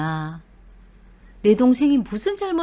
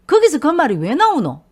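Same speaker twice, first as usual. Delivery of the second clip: louder, higher indignant comeback; indignant retort; 5 semitones higher, 8 dB louder than usual. Retold or imitated. Husband retorting to his wife, loud and indignant. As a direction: fire the question back at her.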